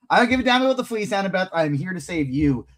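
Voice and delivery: high, rushed mock insistence easing down; acted defiant outburst. Host voicing Percy brushing off the fleece to save Annabeth, mock-defiant. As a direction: blurt it like an impatient rescuer, then settle.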